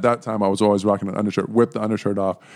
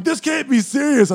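high-pitched mock panic; acted disbelief; 4 dB louder than usual. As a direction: squeal it in disbelief. Acts own panicked disbelief.

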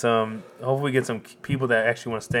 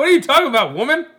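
much higher and louder hammed-up Canadian voice; mock Canadian fan; 14 semitones higher, 8 dB louder, faster than usual. Canadian fan answering his wife in the background, loud mock Canadian accent. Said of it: "And his wife's in the background".

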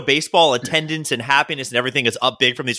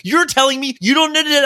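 incredulous daughter; 11 semitones higher than usual. Retold or imitated. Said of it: "she got pretty like".

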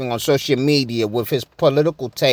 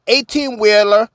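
usual speaking voice

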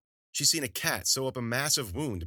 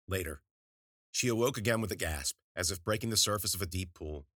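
usual speaking voice

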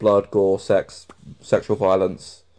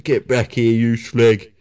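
hammed-up Cockney impression; mock Cockney telling-off; full-on Cockney; 4 dB louder, much slower than usual. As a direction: imitate an EastEnders hard man in Cockney.

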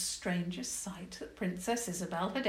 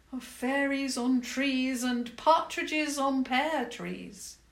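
higher, louder, slow and grand; grand goblin queen; 8 semitones higher, 7 dB louder, slower than usual. Acted. Goblin queen conjures Christmas images, slow grand voice. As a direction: paint the picture slowly and grandly.

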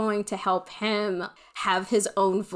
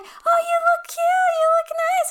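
much higher, louder sing-song praise; cooing school staff; 5 dB louder than usual. Retold or imitated. Staff member cheering up a teary kid, high cooing praise. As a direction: gush at her like a sweet school aide.

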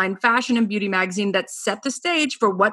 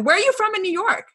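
excited question; 12 semitones higher, faster than usual. Real, not acted. Eager question.

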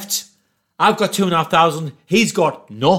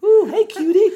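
high, louder flirty coo; flirty woman; 7 dB louder than usual. Retold or imitated. Woman eyeing him up, high flirty coo. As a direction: purr at him. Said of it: "she was like".

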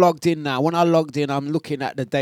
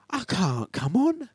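high, slow, whimpering plea; slow, higher mock-pleading voice; imitating Jazzy; 10 semitones higher, slower than usual. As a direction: mimic a helpless friend, drawn out and high. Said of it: "he does talk like that".

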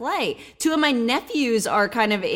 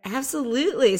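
ordinary talk, nothing marked

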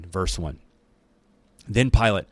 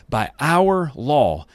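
leaders' loud insistence; 6 semitones higher, 5 dB louder, much slower than usual. A narrator's reading.

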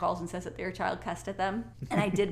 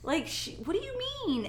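shocked disbelief; 4 semitones higher than usual. Retold or imitated. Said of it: "And I'm like".

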